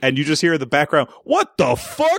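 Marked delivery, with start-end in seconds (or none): 1.2-2.2 high, incredulous yelp; imitating the line. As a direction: toss it off in disbelief. Retelling background line.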